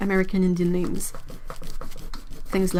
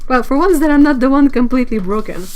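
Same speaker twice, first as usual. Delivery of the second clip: gleeful; 4 semitones higher, 8 dB louder, faster than usual. Real, not acted. Gleeful after laughing.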